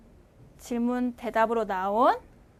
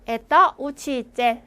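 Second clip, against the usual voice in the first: 에떠 우치이젤 slowed down and a bit louder; slower, clearer repeat; 5 dB louder than usual. Shown as a demonstration. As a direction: say 에떠 우치이젤 again slowly and clearly for the class. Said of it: "한 번 더".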